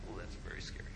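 hushed, breathy fright at the window; scared whisper. Retold or imitated. Retelling the angel sighting, a frightened whisper. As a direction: whisper it like you just saw something. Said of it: "I was like".

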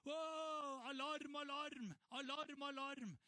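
high loud alarm cry; panicked shout. Retold or imitated. People in a panic shouting alarm, high and loud. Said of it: "Når folk bare roper".

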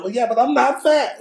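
very high, loud child imitation; insistent little kid. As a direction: protest like a stubborn small son. Son insists, against dad's lecture.

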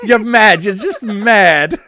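loud, high hammed-up British accent; mock British accent. Yelling that someone's mad, mock British accent. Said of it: "try to do a British accent".

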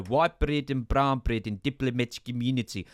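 garbled would-be Australian turning South African; bad South African accent impression; botched South African accent. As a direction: attempt an accent that slides into South African gibberish. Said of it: "went somewhere between South African".

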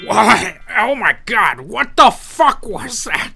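startled groggy god. Acted.

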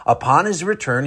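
normal talking voice

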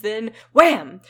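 loud wham; wham sound effect. Acted. Reader voices the kid hitting the ground, loud wham. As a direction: slam the landing.

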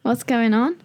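high rising Australian questions; amateur Australian, lifting each ending; attempted Australian accent. Explaining the slang to the friend, attempted Australian accent. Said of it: "do it in the Australian accent".

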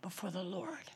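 hushed whisper retelling weeping; soft whispered memory. Retold or imitated. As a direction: breathe it out as a whisper. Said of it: "just started to weep".

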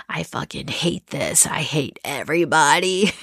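mock-furious whisper, hushed and low; retold angry whisper. Meditator recalling her day-four rage, whispered. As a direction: hiss the complaint under your breath, half-joking.

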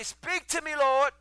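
high, loud plea, building on LORD; crying out to God. Acted. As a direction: call up to heaven at full voice. Calling out to God in prayer.

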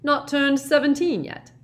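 put-on loud, high, jovial old professor; hearty teacher cheer; genial Slughorn. A narrator's reading. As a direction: boom it out kindly to the class. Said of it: "said Slughorn genially".

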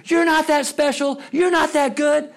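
high-pitched taunting yell; mock yelling. Woman right in a girl's face, mock yelling. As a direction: imitate a parent yelling in a child's face. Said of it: "this woman just start yelling".

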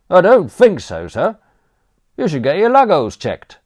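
high, loud cockney manservant offering; chirpy servant barging in with condiments; cheeky butler. Acted. Butler Crawshaw to Aunt Agatha, loud chirpy cockney offer.

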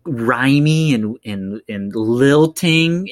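imitating a slow, rhymey lure; soft, slow lilt. Describing the evil caller luring him in, slow and lilting. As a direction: draw the words out in a singsong lilt. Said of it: "he does that one thing where he's soft and slow".

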